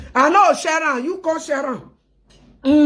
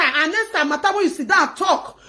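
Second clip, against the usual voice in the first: usual speaking voice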